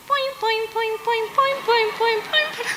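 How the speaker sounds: high comic "poin, poin" noises; comic sound effect. Retold or imitated. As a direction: mimic a jolting freeze. Mimicking sudden stop of dancing. (efecto cómico agudo, "poin, poin")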